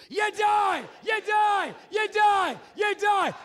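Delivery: loud high shouted chant; group scream. Acted. Host leads the audience in screaming it. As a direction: yell it on the count of three. Crowd chant after count.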